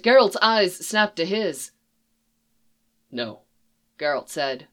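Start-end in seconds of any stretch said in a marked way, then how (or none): 3.1-3.4 low, soft, final no; with finality. A narrator's reading. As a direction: shut it down firmly. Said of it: "with finality".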